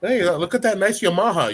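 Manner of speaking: high-pitched mock admirer saying Yamaha the anglicized way; mispronouncing admirer. Instructor mimicking people who say Yamaha, high mock admiration. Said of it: "how people say Yamaha".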